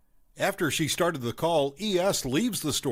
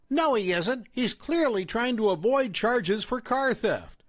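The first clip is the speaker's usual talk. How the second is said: higher-pitched accusing mother; raised mom voice; 8 semitones higher than usual. A narrator's reading. Mom confronting the narrator at the fast food place, accusing. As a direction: play the suspicious mom, pitched up.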